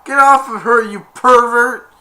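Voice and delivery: high, loud, drawn-out mock scolding; outraged yell. Bystanders hitting the man by the girl, outraged yell. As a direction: shout the accusation. Retold angry accusation.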